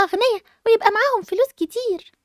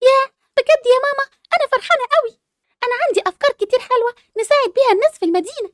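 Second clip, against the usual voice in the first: much higher, sugary put-on delight; fake sweet joy; 7 semitones higher than usual. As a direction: pretend to be the good daughter. Scheming girl fakes joy to mother. (فرحة مصطنعة بصوت عالي ومتصنع)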